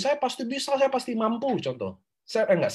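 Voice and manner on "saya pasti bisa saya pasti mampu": higher-pitched recited affirmation; example affirmation. Shown as a demonstration. Coach quoting an affirmation for the class, high-pitched sample. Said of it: "contoh saya mau ngomong nih".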